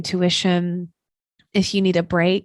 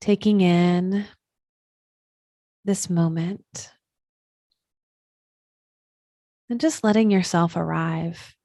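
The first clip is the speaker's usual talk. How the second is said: guided meditation. Acted. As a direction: settle them into stillness, unhurried and even.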